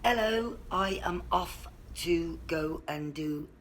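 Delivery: ordinary talk, nothing marked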